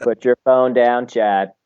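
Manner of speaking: slow, hammed-up American buddy talk; mock American accent. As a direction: coax him in a drawn-out American accent. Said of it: "best American accent saying".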